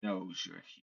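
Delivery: softly voiced cryptic stranger; lightly played ominous quiet; hushed figure. Game master voices mysterious helmeted figure.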